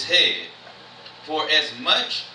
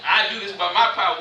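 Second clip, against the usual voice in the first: quick, raised, proud claim; boastful Moses; 7 semitones higher, 4 dB louder, faster than usual. Retold or imitated. Moses at the rock, boasting to Yah, proud and quick. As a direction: brag that the power is his own. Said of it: "he was like".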